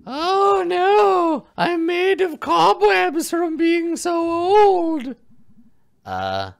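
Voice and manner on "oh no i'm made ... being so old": high, slow cackling crone; old hag. Acted. Witch part.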